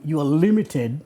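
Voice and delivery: ordinary talk, nothing marked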